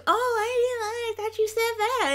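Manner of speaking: put-on high-pitched innocent act; imitated downplaying. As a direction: brush it off in a silly high voice. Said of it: "starts joking and downplaying it".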